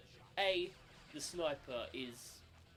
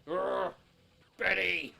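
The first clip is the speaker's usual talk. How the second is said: loud, higher monster roar; Hulk grunt; 5 semitones higher, 12 dB louder, much slower than usual. Retold or imitated. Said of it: "just grunting".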